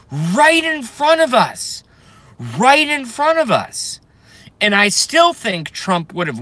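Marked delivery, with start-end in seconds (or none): 0.1-1.8 outraged shout. Real, not acted. Outraged at stolen nomination. 2.6-4.0 outraged shout. Real, not acted. Outraged at stolen nomination.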